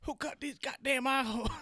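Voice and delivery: high put-on voice of a fussy Klan member; Klan member whine. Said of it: "My favorite part in that movie".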